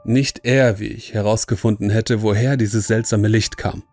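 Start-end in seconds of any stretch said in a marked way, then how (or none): none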